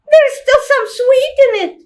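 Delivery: put-on a defiant small child; little girl's voice. Little sister protesting she won't be a person, high child voice. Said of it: "children's voice".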